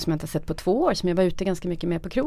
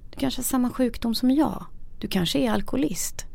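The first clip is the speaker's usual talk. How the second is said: gentle; 6 semitones higher than usual. Retold or imitated. Friend gently revealing.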